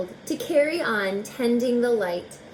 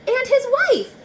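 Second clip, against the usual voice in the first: much higher and louder, thrilled; delighted reveal; 8 dB louder than usual. Acted. Reader revealing the keeper's wife, thrilled and high. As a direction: announce her like a happy surprise.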